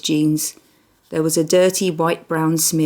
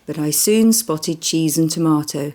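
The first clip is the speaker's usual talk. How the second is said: ordinary delivery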